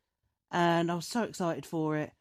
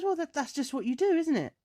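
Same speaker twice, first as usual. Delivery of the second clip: lightly voiced boyfriend, pitched up high; retold oblivious excuse; imitated clueless boyfriend; 8 semitones higher than usual. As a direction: play his innocent excuse high and light. Acting out boyfriend's casual excuse.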